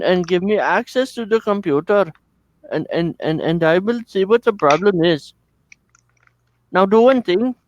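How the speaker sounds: high-pitched mock Indian accent; Indian call center agent. Dell tech support agent guides a caller, high mock Indian accent. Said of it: "to a call center in India".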